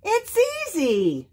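very high, small animal voice; little possum voice. A narrator's reading. Joe reassuring the bear, high possum voice. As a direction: chirp it up high.

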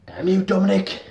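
loud, high cry of cursing; hammed-up high yell; mock outraged shout. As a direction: shout it melodramatically at a villain. Mock curse at a soap character.

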